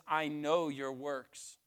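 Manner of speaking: low, slow and weighty; God to the church, slow and grave; solemn, slow. Acted. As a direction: let the words fall heavy and slow.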